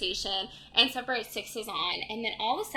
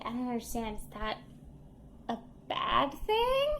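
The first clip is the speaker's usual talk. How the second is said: imitated confused child; 7 dB quieter than usual. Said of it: "And then Madeline's like".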